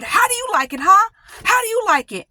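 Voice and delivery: high, loud mocking taunt; loud high retold taunt from husband; taunting abuser. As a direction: taunt her back with a high, loud jeer. Husband mocking her on the couch.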